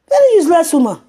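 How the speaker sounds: "Very useless woman" yelled high and loud, "Very" hit hardest; shouted insult. Real, not acted. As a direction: scream "Very useless woman" with "Very" punched.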